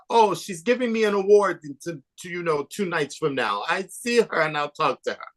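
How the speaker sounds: breezy name-dropper in a put-on accent; Iman. Filmmaker mimics the queen who knows everyone, high mock accent. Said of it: "That's my bad Iman, like, accent".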